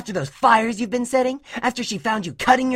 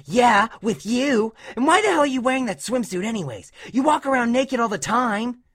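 scornful retort; 4 dB louder than usual. A narrator's reading. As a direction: needle the kid in a high voice. Dash sneers back in quarrel.

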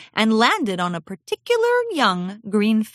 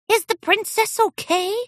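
much higher little filly voice calling out; small filly calling; 14 semitones higher than usual. Acted. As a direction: call out anxiously as a tiny filly. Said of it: "Gemma called out".

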